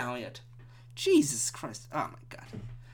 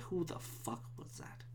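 baffled mutter; 20 dB quieter than usual. Real, not acted. Podcaster wondering who yelled at him, very soft mutter. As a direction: mutter it almost inaudibly.

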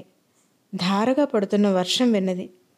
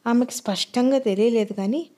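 ordinary delivery, nothing marked

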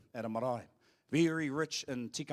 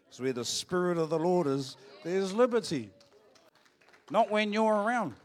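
forceful preaching; 4 semitones higher, 7 dB louder than usual. Acted. Preaching climax to the congregation.

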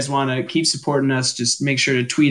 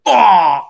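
Boba Fett cry; 5 semitones higher, 7 dB louder than usual. Retold or imitated. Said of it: "Boba Fett who goes".

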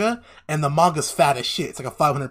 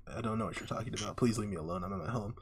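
much softer, lower mumbled voice; retold meek plea; 5 semitones lower, 12 dB quieter, faster than usual. Sculptor asked where she is, low meek plea. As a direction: imitate someone meekly begging off, very quietly.

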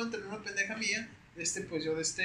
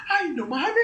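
laughing exclaim; 11 semitones higher, 8 dB louder, slower than usual. Retold or imitated. Claudia reacts, laughing.

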